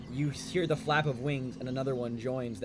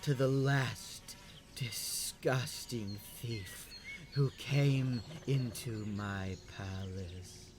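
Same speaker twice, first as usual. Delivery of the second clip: slow, deliberate threat; menacing queen; slower than usual. Acted. Queen threatening a thief in her palace, slow menace. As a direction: draw each phrase out with cold contempt. Game master plays the angry queen.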